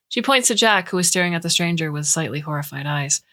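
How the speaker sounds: usual speaking voice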